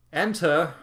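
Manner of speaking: high, loud summons through a door; calling out. Acted. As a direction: call out as the castle's lord. Castle lord calls through door.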